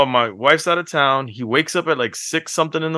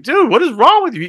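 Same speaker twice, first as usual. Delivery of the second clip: high, louder incredulous scolding; exasperated dad; 13 semitones higher, 4 dB louder than usual. Retold or imitated. Father scolding son over his tantrum, high and exasperated. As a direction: scold him like you can't believe this. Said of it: "And I'm like".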